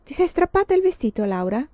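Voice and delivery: teacher sneering at Laura; sour voice. Acted. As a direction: needle the girl with acid. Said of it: "con una strana voce acida".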